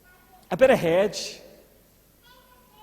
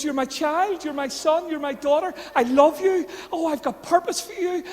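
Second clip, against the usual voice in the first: higher, earnest and tender; loving father voice; 6 semitones higher than usual. Retold or imitated. God telling the listener he loves them, higher and tender. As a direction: speak love straight into them. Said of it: "he looks at you and he goes".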